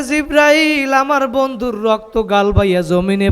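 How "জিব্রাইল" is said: high, chanted summons; calling out. Acted. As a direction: call the angel in a raised, ringing tone. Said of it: "আল্লাহ বলে". (উঁচু সুরে ডাক)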